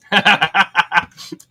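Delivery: bursting out laughing at a joke; loud amused laugh; real laugh. Real, not acted. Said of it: "Oh, Shell, that was funny".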